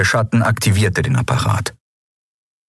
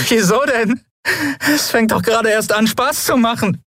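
high, manic mocking defiance; hysterical defiance. A narrator's reading. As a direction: laugh in the face of torture. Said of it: "mehr als einen Anflug von Hysterie".

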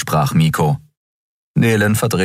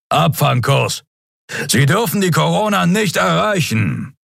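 higher, pressing order to intercept; urgent command; 12 semitones higher than usual. A narrator's reading. Skria gives urgent order. (dringender Befehl, deutlich höher)

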